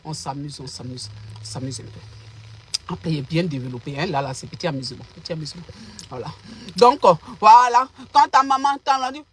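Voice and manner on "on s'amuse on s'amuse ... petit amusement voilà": much softer, murmured apology; quiet aside. Real, not acted. Apologizing to viewers, soft near whisper. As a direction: drop to an almost whispered aside.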